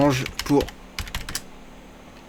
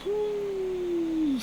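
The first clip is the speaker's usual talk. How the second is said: high squeal; 8 dB quieter than usual. Real, not acted. Poker streamer reacting.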